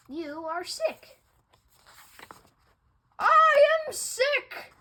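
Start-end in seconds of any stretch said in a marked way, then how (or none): 3.2-4.7 much higher, louder, slower joyful cry; relieved exclaim. Acted. Gerald answering Doctor Cat, overjoyed relieved cry. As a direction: celebrate the diagnosis.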